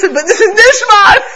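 high, loud shout through a laugh; hammed-up laughing cry. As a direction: protest joyfully, all lungs. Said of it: "The Chassid says".

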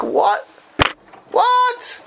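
very high, incredulous what, what; flabbergasted Tosfos. Acted. Teacher playing Tosfos reacting to the Gemara, flabbergasted. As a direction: squeal it as if the Gemara makes no sense. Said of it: "And he's like".

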